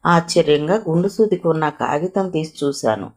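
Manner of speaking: plain reading voice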